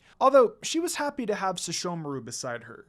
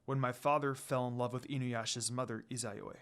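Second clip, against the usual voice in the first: lower, softer lord confessing his love; a reserved lord opening his heart quietly; tender confession; 5 semitones lower, 6 dB quieter than usual. A narrator's reading. Sesshomaru's confession.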